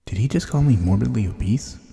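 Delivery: loud, offended disbelief; can't believe what he just heard; indignant disbelief. Retold or imitated. Indignant at doctor's remark.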